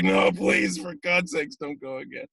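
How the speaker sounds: high mock-begging, fading at the end; laughing plea. Tearful casting team begging the actor to stop, laughingly. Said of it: "they're all laughing".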